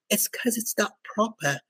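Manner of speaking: mock a proper Southampton English accent quietly. Retelling Pilko's reply about mushy peas, soft mock Southampton English accent. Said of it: "He sells that Southampton, ha-, Southampton, uh, accent so hard".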